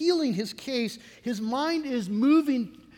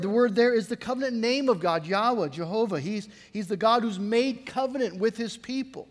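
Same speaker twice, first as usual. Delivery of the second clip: normal talking voice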